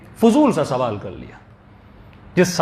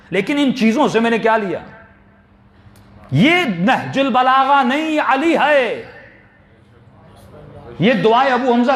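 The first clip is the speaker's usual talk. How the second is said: impassioned preaching; 6 semitones higher than usual. Acted. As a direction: rouse the congregation with fervour. Preaching at full force.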